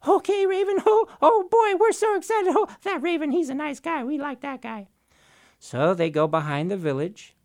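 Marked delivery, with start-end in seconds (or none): 0.1-4.9 very high, loud chirpy chatter; tiny birds gushing over Raven; squeaky overjoyed bird voices; excited little birds. Acted.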